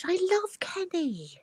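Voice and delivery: ordinary talk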